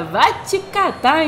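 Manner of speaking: playful exclamation. Real, not acted. Host exclaims over Mbappé.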